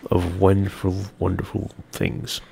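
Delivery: ordinary talk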